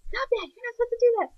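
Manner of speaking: high, fast mock yell at a toddler; mother imitating yelling at her two-year-old, high and fast; mock loud yell. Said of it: "you know, in a loud voice".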